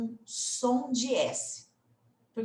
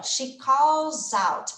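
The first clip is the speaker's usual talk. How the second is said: slow model reading; 3 semitones higher, 6 dB louder, much slower than usual. Shown as a demonstration. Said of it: "Ele começa aqui, ó".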